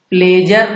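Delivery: "प्लेजर" with a j and sounded final r; wrong pronunciation. Shown as a demonstration. Teacher warning learners off a misreading, wrong pronunciation "प्लेजर". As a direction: misread pleasure as "प्लेजर", PLAY-jer. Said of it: "प्लेजर नहीं पढ़ना है".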